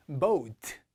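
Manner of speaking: boat as BO-UT, long vowel; o gliding to u. Shown as a demonstration. Teacher modelling for students to repeat, boat as BO-UT. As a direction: let boat slide from o to u. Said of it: "제가 읽으면 따라해 보세요".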